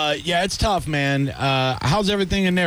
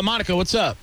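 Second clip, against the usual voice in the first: ordinary delivery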